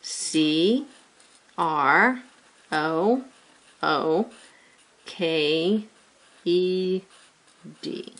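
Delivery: "C", "R", "O" each letter named with pauses; spelling letter by letter. Shown as a demonstration. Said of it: "Ready, begin".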